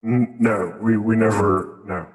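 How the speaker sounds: usual speaking voice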